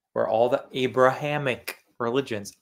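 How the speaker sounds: ordinary delivery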